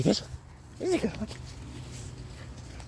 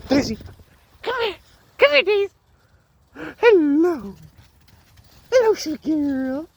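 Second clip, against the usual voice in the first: high cooing call to a dog; 13 semitones higher, faster than usual. Real, not acted.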